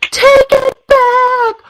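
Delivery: very high, frantic imitated wail; mock sobbing child. Mother voicing her six-year-old pleading with his brother, high mock sobbing. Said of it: "and he's sobbing".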